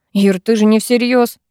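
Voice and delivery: plain reading voice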